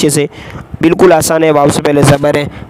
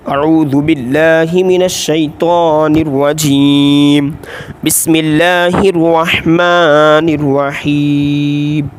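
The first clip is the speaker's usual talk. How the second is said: slow, drawn-out tajweed recitation; solemn measured chant; Quranic recitation; much slower than usual. Acted. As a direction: recite it slowly and reverently, stretching each word. Opens practice with Quranic recitation.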